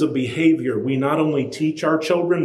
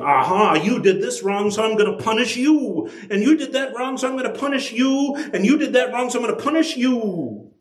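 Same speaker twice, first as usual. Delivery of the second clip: higher, singsong accusing; mock accusing judge; 8 semitones higher than usual. Preacher mocks a picture of God punishing every wrong. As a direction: tick off each wrong mockingly.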